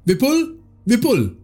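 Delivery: high calling shout into the dark; shouting a name. Acted. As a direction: call out for a lost son. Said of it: "Tarek shouted".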